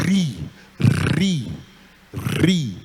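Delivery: ri with a strong rolled r. Shown as a demonstration. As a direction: roll the r in ri. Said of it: "Ela transforma o R de ri em".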